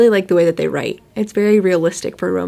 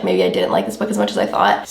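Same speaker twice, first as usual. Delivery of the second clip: low inner voice; 12 semitones lower, 3 dB quieter than usual. Retold or imitated. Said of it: "I kinda was like".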